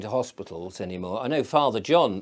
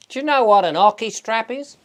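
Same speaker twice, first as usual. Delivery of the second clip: put-on higher and louder Australian accent, insistent; Barbara's Australian accent; 11 semitones higher, 8 dB louder than usual. Barbara O'Neill repeating her question, louder Australian accent. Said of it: "'Cause she's Australian".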